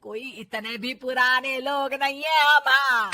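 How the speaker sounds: loud, huffy saas; mother-in-law talking loudly over her bahu; irritated mother-in-law. Acted. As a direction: retort loudly as an offended elder.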